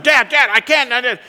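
put-on high, loud childish wailing; upset little girl. Daughter still fretting over her shoe calls to dad, imitated tantrum. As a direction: mimic a panicked toddler pleading. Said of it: "She's still looking at her shoe".

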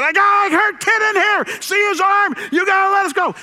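high, loud, frantic shout; yelling. Retold or imitated. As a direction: reenact yelling urgently at a policeman. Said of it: "I yelled at the policeman".

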